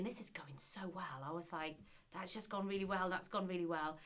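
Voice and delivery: softly voiced thought to oneself; quiet, self-assured aside in a retelling; private thought. Candidate telling herself it went well, hushed inner voice.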